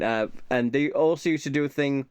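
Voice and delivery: normal talking voice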